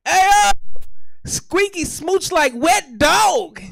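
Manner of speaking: high, loud shout, drawn out at the end; big comic yell; calling out loudly. Acted. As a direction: holler it out, stretching the last words. Calls out in an acted bit.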